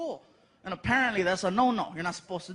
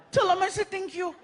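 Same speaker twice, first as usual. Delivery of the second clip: put-on kid's question; 10 semitones higher than usual. Frankie seeing the cakes, high excited kid voice. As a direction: ask like an amazed little boy.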